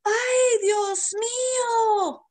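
high gasp then a slow, loud, high "Ay, Dios mío"; shocked gasp. Retold or imitated. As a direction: play the horrified mom at the sight of her daughter. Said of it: "my mom was like".

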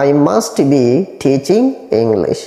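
very slow, drawn-out teaching read; slow model sentence. Shown as a demonstration. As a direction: stretch the words for learners. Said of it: "teacher models the sentence". (ধীরে ধীরে শেখানো)

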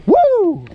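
high loud joyful whoop. Real, not acted. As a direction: cheer it out high. Celebrating the catch.

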